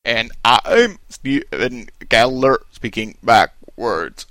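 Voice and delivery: slow, warped Twin Peaks backwards voice; backwards speech. Acted. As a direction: drag it out like reversed audio. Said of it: "trying to sound like the backwards characters in Twin Peaks".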